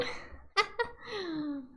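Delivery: breathy little laugh. Real, not acted. Player amused at climbing the door, soft laugh. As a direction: laugh softly to yourself.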